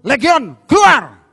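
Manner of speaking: loud, forceful high command; preacher acting Jesus casting out Legion; commanding shout. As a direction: cast the demon out with full force.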